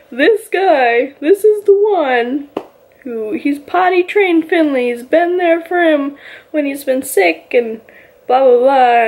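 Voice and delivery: high, excited raving about him; gushing praise. Retold or imitated. Telling Nick how great Cord is, high excited gushing. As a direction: hype him up like his biggest fan.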